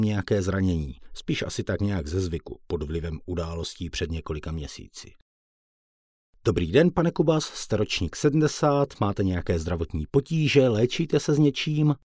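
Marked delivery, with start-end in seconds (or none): 6.4-11.9 a hint of a formal doctor, higher; lightly voiced doctor. Doctor questions patient.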